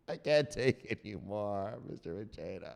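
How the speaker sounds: soft, low, fading whimper; broken surrender. Acted. Victim giving up to the enforcer, fading quietly. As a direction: let the voice sink away, defeated. Victim breaks down after being hit.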